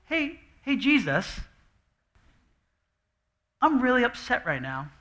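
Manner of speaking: mock polite complaint. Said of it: "No, no, no, no, no".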